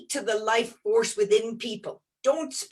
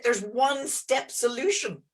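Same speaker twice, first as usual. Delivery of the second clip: ordinary talk, nothing marked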